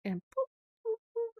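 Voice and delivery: high, squeaky poop-poop horn; imitated motor horn. A narrator's reading. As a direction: toot it like a car horn. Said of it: "strange suppressed noises inside him".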